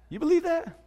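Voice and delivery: high, incredulous mock voice; mocking scoff. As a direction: scoff at the believer's faith. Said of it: "they'll say".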